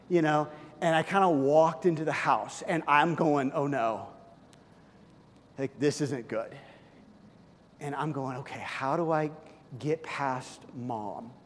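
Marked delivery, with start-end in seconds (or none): 3.5-11.3 put-on soft, low worried kid; quiet nervous inner voice; worried muttering. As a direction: hush it like sneaking past mom. Boy's worried inner thought.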